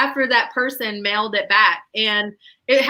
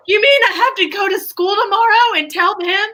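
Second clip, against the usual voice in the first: much higher, louder incredulous question; can't believe it; disbelief; 10 semitones higher, 4 dB louder than usual. Retold or imitated. Daughter asking mom in disbelief about school, much higher and louder. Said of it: "I was like".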